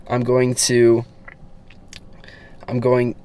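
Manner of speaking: halting restarts on the same words; stuttering. Real, not acted. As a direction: stumble and restart the sentence. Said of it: "I'm stuttering here right now".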